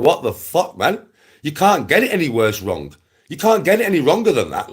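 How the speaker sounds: high, loud incredulous outburst; retold disbelief. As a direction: act out the shocked reaction. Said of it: "I burst out laughing".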